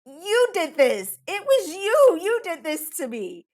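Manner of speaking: accusing. Retold or imitated. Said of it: "But I'm like".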